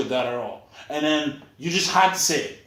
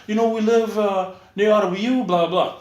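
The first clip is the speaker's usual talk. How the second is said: mock girl voice; 6 semitones higher, 3 dB louder than usual. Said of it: "those girls are like".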